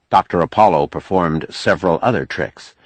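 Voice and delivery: ordinary delivery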